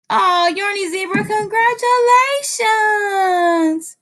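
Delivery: high, long-drawn congratulatory squeal; gushing congratulations. Real, not acted. Streamer congratulating a viewer, gushing. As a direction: hold the last word out high and long.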